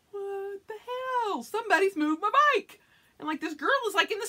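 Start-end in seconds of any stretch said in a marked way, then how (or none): 0.1-2.8 indignant surprise. Retold or imitated. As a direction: sputter in disbelief at the empty spot. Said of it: "and I'm like".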